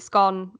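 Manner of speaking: "scone" with a short O, like "skon"; short-o pronunciation. Shown as a demonstration. Baker mocking people who pronounce it so, "scone" with a short O. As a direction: say "scone" to rhyme with gone. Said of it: "people who pronounce it".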